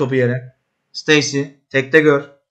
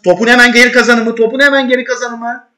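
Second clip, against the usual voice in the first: higher and louder, pressing command; loud urgent order; 7 semitones higher, 5 dB louder than usual. Real, not acted. Manager demanding his team win the ball back, loud urgent order. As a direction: drive the instruction home.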